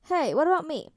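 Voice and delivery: Tony mock protests to Peter, playfully offended. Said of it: "Tony threw his hands up".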